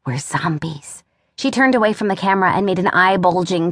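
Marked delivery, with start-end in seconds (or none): 0.1-1.0 low, hushed aside; softer and lower, confiding; whispered. Acted. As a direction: drop to a secretive near-whisper. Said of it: "Julia whispered".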